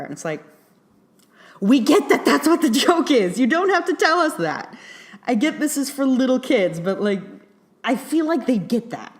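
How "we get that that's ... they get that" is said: higher, louder real annoyance; exasperated. Real, not acted. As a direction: rant as if talking back to the screen. Exasperated rant at the movie.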